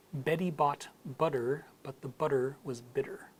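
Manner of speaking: American accent, T's almost D's. Shown as a demonstration. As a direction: show the American flap T through the tongue twister. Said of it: "turns into almost a D sound".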